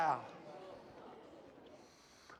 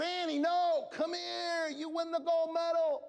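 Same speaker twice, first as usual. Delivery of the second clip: higher, calling across the track; coach calling out; 11 semitones higher than usual. Retold or imitated. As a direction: urge her on from the sideline. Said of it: "And I said".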